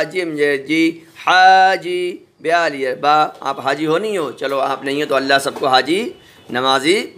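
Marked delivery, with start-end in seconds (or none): 1.2-2.3 slow blended word. Shown as a demonstration. Teacher showing pupils the joined word दादी, slow and clear. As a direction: stretch दादी out slowly and a bit louder, syllables joined. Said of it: "हाल ये हा".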